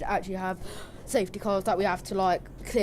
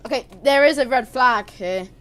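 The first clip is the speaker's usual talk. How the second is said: shouted, much higher and louder play-by-play; excited crash commentary; 7 semitones higher, 11 dB louder than usual. Acted. Commentator reacting to a crash on track, shouting. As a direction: call the crash like breaking news.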